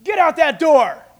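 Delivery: barked order. Retold or imitated. Policeman orders the congregation out, loud barked command. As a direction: bark it like a cop. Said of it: "if a policeman came in and said".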